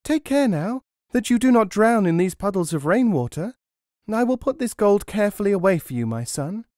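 high quick mother voice; mother's voice. A narrator's reading. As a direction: fuss over her son while taking the gold. Mother speaking to her son.

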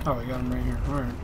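ordinary delivery

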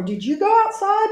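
sing-song high question voice; asking voice. Shown as a demonstration. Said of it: "Bob asked".

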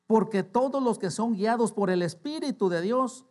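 loud, high proclamation of scripture; emphatic scripture reading. Real, not acted. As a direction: proclaim the verse to the congregation. Reads verse to congregation.

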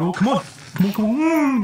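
high, loud come on, come on; will it to happen, loudly; frantic urging. Real, not acted. Streamer fights a zombie, frantic high urging.

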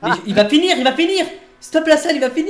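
aigu et fort, presque en criant; surexcité. Real, not acted. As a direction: presse tout le monde, paniqué. Course serrée, supplie qu'on stoppe. (cri excité)

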